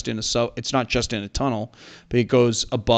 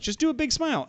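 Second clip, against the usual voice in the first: high-pitched mock photographer voice; Marius; faster than usual. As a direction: imitate a photographer coaxing, pitched way up. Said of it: "And then he was like".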